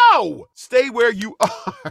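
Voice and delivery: high, slow pleading with a laugh; pleading plea. Retold or imitated. Fan begging his team not to move, high and slow. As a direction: beg them to stay, drawn out and high. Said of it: "I'm like".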